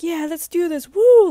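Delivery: high, loud hyped shout ending in a whoop; shouting, amped. Retold or imitated. Driver shouting at his passenger, all amped. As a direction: whoop it like a fired-up fan.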